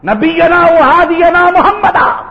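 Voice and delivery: high, slow ringing call; loud fervent cry. Retold or imitated. Martyr's son crying out to the Prophet, loud and drawn. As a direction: stretch each word out high and loud. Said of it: "تو کہا".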